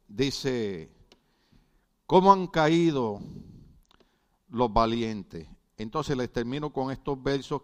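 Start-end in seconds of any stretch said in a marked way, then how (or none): 2.1-5.5 grave quoted lament; a touch of solemn reading. Acted. Preacher rereads the lament of David to the congregation, gravely. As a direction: repeat the verse with weight.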